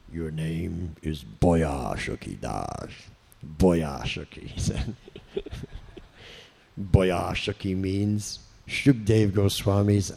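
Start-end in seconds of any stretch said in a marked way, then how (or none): 0.1-4.5 put-on slow, low thick Bengali accent; Prabhupada's Bengali accent. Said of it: "had this very thick Bengali accent". 6.8-10.1 put-on slow, low thick Bengali accent; Prabhupada's Bengali accent. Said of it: "had this very thick Bengali accent".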